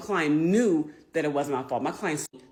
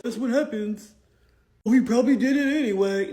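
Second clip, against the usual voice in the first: raised, loud imitation of callous indifference; mock dismissive; 5 semitones higher, 6 dB louder than usual. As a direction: mimic someone brushing it off, pitched up. Mocking indifferent lawyers' attitude.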